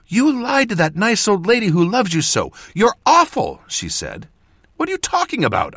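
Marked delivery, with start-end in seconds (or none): 0.1-3.6 very high, loud accusation; indignant scolding. A narrator's reading. Apprentice scolding mentor for lying, indignant. 4.8-5.8 high, fast, indignant question; rapid, baffled demand. A narrator's reading. Mentor answering apprentice's accusation, demanding. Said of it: "I demanded".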